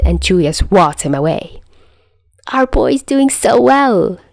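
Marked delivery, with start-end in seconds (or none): 2.5-4.2 high, slow doting voice; mock proud parent.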